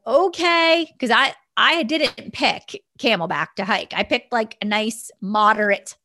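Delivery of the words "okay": high, drawn-out dubious okay; reluctant agreement. Retold or imitated. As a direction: go along with it, voice pitched up. Reluctantly agrees to harder hike.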